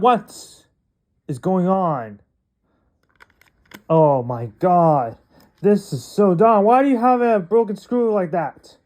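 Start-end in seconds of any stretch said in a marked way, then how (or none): none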